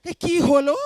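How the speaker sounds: a loud, startled security guard calling out in Bengali; guard outside the tent, hearing the noise: loud puzzled call. Retold or imitated. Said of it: "Let the security guys outside wonder".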